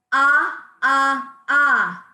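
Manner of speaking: three loud punched ahs from the belly; loud staccato ah. Shown as a demonstration. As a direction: pump each ah out loud and equal. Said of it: "Number two".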